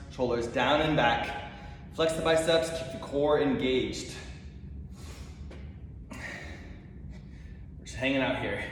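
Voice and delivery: usual speaking voice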